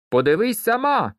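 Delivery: put-on high, insistent child's retort. A narrator's reading. Peter won't let it go with Malot, high and insistent. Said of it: "не вгавав Петер".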